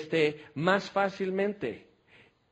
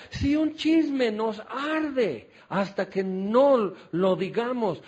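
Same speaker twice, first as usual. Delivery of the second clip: slow emphasis; 3 semitones higher, slower than usual. Real, not acted. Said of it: "preacher urging".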